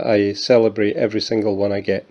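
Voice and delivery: ordinary talk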